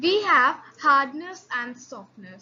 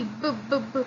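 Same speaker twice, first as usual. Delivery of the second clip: ordinary talk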